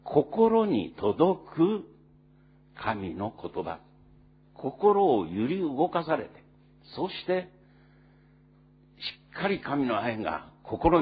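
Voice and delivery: high, loud, slow preaching at full force; fervent preaching. Acted. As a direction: drive each phrase home to the congregation. (力を込めて高く強く説教する)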